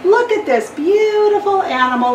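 a trader mocking the donkey to the crowd. A narrator's reading. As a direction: sneer while pretending to admire. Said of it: "decided to make fun of".

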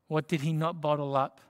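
ordinary delivery

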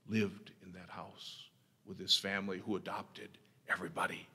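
breathy whispered tribute. Acted. Preacher remembering the pastor's family to the congregation, whispered. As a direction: whisper it like a secret kept dear.

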